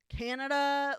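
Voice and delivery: imitating someone blurting it out loud; loud, indiscreet mock announcement; really loud. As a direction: blurt it far too loudly. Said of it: "saying it really loud".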